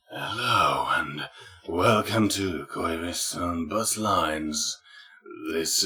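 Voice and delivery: low, soft, slow breathy drawl; sexy announcement voice. Retold or imitated. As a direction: imitate a bus driver trying to sound seductive. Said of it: "this weird announcement voice".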